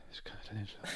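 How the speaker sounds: whimpered whisper. Retold or imitated. Drunk Giovanni begging not to sleep alone, whispered. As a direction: breathe it out like a pitiful drunk.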